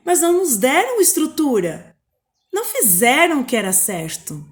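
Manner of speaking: spoken-word performance rising to an outcry; slam poem reading. Acted. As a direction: build it into a protest cry. Reads slam poem excerpt.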